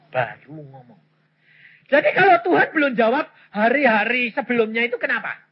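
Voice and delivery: ordinary talk, nothing marked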